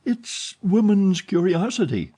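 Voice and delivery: put-on a frightened girl feigning lightness; forced playful tone. A narrator's reading. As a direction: cover the fear with playfulness. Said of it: "in a tone which she tried to render playful".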